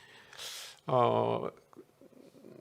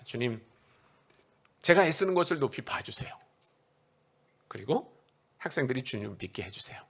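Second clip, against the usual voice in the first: retold prayer; 4 semitones lower than usual. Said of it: "그렇게 기도했어요".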